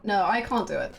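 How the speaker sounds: normal talking voice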